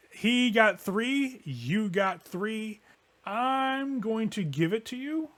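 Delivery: high, slow, stretched-out delivery; very slow, lilting and high; drawn-out reveal. Acted. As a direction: stretch each word out to tease the reveal. Game master drawing out a reveal.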